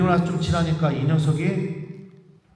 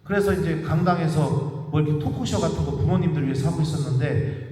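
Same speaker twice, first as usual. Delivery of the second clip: ordinary delivery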